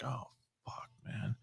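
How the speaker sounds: retold frightened whisper under his breath; scared whisper. Scared at night, ghost in kitchen.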